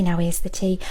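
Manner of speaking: ordinary delivery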